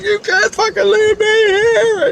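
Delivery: very high, slow wail; hammed-up desperate whine; panicked pleading. Begging Dawn not to leave her alone, panicked pleading.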